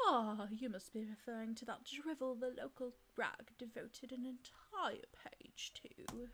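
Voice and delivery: disdainful aristocrat, slow and hushed on the scornful words; haughty snobbish bird. Acted. Snobbish bird scorning the local paper, softly disdainful. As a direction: sneer softly at the vulgar masses.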